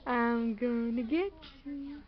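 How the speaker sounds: quiet playful. Acted. Chase game.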